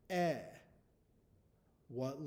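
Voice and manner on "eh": "Eh" as a short e, on its own; isolated vowel sound. Shown as a demonstration. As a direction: say "Eh" as the vowel in pet. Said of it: "What letter makes this sound".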